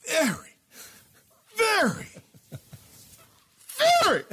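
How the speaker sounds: host teases secret news, drawing out the very; building hype. Real, not acted. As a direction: stretch out each very to tease the news.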